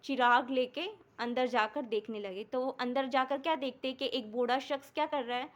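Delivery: normal talking voice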